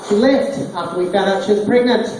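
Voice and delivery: ordinary talk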